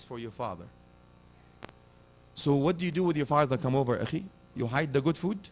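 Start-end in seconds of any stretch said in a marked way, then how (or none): none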